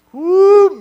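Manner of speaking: very high, loud exclaimed whoo. Real, not acted. Preacher recalling his past, high loud whoo. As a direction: let out a knowing whoo.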